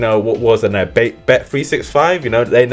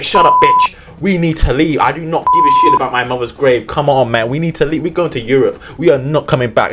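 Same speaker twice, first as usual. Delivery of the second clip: louder, higher mock-angry snap; angry father; 4 semitones higher, 4 dB louder than usual. Father ordering his daughter to flee, loud mock anger. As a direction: bark at her to get moving. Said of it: "he's like".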